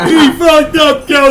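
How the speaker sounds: laughing while yelling it; laughing shout. Real, not acted. Laughing repeat of accusation.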